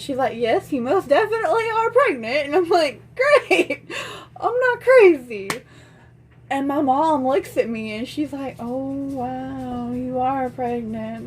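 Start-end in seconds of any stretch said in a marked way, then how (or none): 0.4-2.5 higher-pitched emphatic confirmation; imitated chirpy doctor. 3.1-5.5 high, loud vindicated outburst; mock triumphant relief. Vindicated daughter, retold. 8.6-11.3 slow, drawn-out amazement; imitated stunned mom. Mother finally believing, retold.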